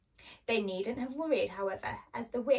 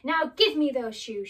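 loud, higher demand; angry witch; 4 semitones higher, 8 dB louder than usual. Acted. Witch lunges at Dorothy for the shoes, angry. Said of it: "The witch became very angry".

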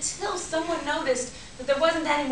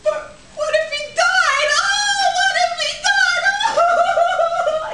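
high, loud, slow sobbing wail; wailing cry; 6 dB louder, much slower than usual. Acted. Bride telling her mother her fear, hammed-up wail. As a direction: stretch it into a hammed-up lament. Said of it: "she started crying again".

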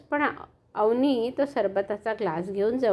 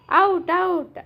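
mock shout; 5 semitones higher, 4 dB louder, much slower than usual. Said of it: "Avni व इतर मुलं ओरडली".

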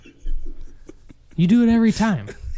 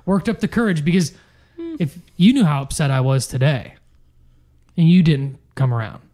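ordinary delivery

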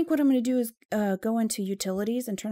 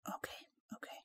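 whispered, trying to stay calm; tense whisper; 16 dB quieter, faster than usual. Real, not acted. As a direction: breathe out okay, okay quietly.

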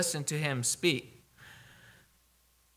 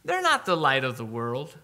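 scornful Pharisees; 6 semitones higher than usual. Retold or imitated. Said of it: "Pharisees are probably like".

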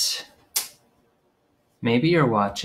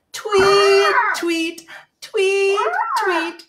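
high chirpy tweet, like a little bird; birdie tweet; much slower than usual. Shown as a demonstration. Teacher showing kids a bird's tweet, high chirp. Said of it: "Can you sing tweet?".

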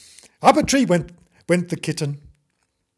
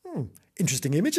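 usual speaking voice

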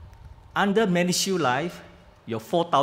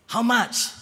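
put-on high, surprised old auntie; elderly resident voice; 8 semitones higher, 3 dB louder than usual. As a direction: ask like a worried auntie. Resident asks price.

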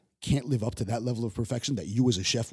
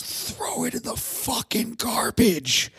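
much higher, louder, slow drawn-out scream; furious yell; 6 dB louder, much slower than usual. Retold or imitated. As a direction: bellow it at the line cook. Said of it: "And then he fucking yells".

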